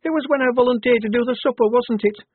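put-on high, loud, worried girlish recruit; embarrassed Shufti. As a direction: pitch it right up, asking for confirmation. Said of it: "Shufti mumbled".

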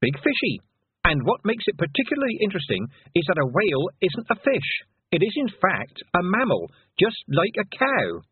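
delighted little lecture. A narrator's reading. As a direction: explain it happily to a small child. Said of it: "Tiffany said, delighted".

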